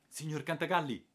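calling out high and loud; urgent shout to a companion. Acted. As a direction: yell his name across the dark.

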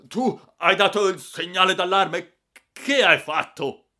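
high, very loud accusation; yelled scolding question; furious shouting. A narrator's reading. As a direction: round on the boy in outrage. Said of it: "gridò il signor Clodoveo".

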